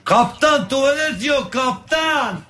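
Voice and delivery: desperate passenger. Acted. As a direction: beg the captain, drawn out. Desperate passenger calls captain. (çaresiz yolcu yakarışı)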